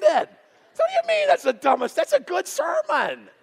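high, shouted comic indignation; mock offended. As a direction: protest loudly in exaggerated outrage. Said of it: "I was offended".

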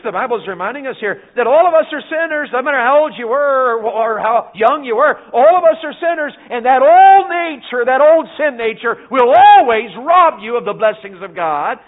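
building to a loud, high finish; preaching climax. Acted. Preacher warning the church about the sin nature, building loud. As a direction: build the fire to the last line.

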